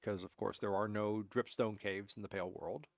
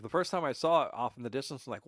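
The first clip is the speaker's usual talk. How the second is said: normal talking voice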